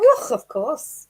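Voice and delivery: loud, high, drawn-out Scottish; slow, exaggerated Scottish burr; mock Scottish accent. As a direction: answer in a hammed-up Scottish brogue. Answering remark about sounding like Scotty.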